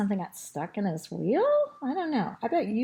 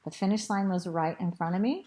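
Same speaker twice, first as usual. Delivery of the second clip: plain reading voice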